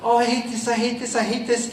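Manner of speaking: acted-out anxious inner thought; inner protest. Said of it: "Even if in my head".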